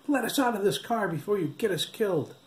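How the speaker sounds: high, panicked plea; frantic cry. A narrator's reading. Boy in the back seat pleading with the cop, high frantic cry. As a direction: beg to be let out, scared. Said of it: "Ricardo cried".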